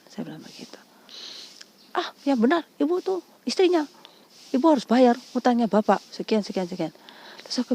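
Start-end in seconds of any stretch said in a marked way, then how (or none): 2.0-6.9 high-pitched mock accusing; imitating insistent collector. Collector insists she is the wife, mock accusing. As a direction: press her like a disbelieving collector, voice raised in pitch. Caller disputes denial, demands payment.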